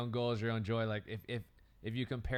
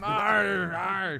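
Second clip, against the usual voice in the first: loud hammed-up pirate growl; 9 semitones higher, 8 dB louder than usual. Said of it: "like, a pirate patch going".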